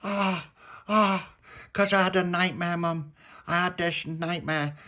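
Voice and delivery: puppy telling his mum his nightmare; little pup. Acted. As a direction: whine it like a hungry little pup. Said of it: "And he went".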